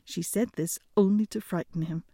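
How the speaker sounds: plain reading voice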